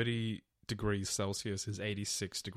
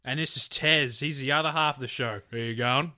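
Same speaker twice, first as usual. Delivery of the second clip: loud, higher broad Aussie bogan intro; mock bogan Aussie host; 4 semitones higher, 6 dB louder than usual. Co-host introducing herself in a hammed-up bogan accent. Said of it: "we start off every episode going".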